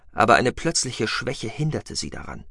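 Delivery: plain reading voice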